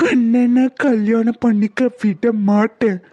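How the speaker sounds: very slow, drawn-out eerie vow; haunting acted menace; vengeful ghost. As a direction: draw out the threat like a spirit. Ghost vows to stop the wedding.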